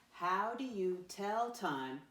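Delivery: slow, deliberate classroom read-aloud; model reading. Shown as a demonstration. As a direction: read it out slowly, word by word. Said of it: "Let me read it the first time".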